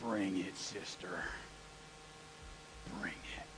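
very low, slow and soft defiance; quiet dare; low muttered dare; defiant challenge. Retold or imitated. Defiant response to teacher.

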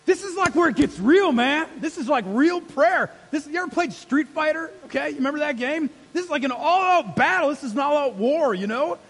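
high, loud, impassioned preaching; full-force pulpit preaching; fiery preaching. Real, not acted. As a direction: fire up the room.

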